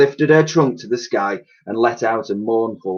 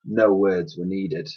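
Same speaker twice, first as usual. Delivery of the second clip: usual speaking voice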